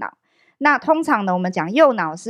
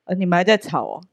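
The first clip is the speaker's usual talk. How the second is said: lightly voiced friend, pitched lower; deep-voiced female friend; 4 semitones lower than usual. Friend remarks on the couple still arguing, lightly imitated deep voice. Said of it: "其实这个女生声音很粗啦".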